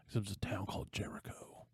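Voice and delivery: hushed dramatic whisper. Real, not acted. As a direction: lean in and breathe it like a secret. Dramatic aside in the story.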